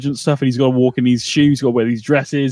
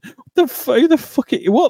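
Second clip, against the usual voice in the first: high, spluttering disbelief; acted-out outrage, pitched way up and falling apart; incredulous; 12 semitones higher than usual. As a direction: stumble over it in high-pitched shock. Reacting to the shocking question.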